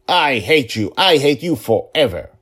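put-on loud, high furious child; a little girl screaming at her father; shouting. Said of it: "she shouted".

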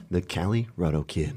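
low, soft, slow sultry voice; sultry narrator. Shown as a demonstration. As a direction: breathe it out low like a movie trailer. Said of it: "I give you my sultry voice right there".

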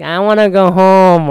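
mock child whine; imitating a whiny kid. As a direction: play the tired child nagging. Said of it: "It's like".

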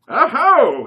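high loud hammed-up British "Aho"; a bad put-on posh British exclamation; fake British accent. A narrator's reading. Plump man applauding Dave and Jacques, fake British accent. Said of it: "obviously fake British accent".

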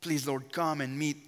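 ordinary talk, nothing marked

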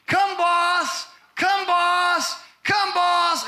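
high, loud calling-the-cows shout; farm call yell. Retold or imitated. Grandfather calling the cows up the hill, shouting. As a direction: call the herd home across the valley. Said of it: "was yell these words".

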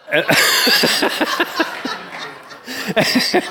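real giggling laugh, high and breathless; high breathy giggle. Real, not acted. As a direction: burst into a high airless giggle. Laughing at picture above bed.

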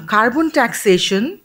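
normal talking voice

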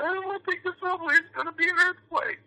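mocking scared Angeleno. Mocking scared LA people who avoid the subway, shrill and high. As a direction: squeak it out like a nervous local. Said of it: "People in L A are scared".

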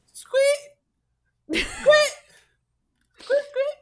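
very high squeaky noise; squeaky rubber duck. Acted. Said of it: "What does that sound like?".

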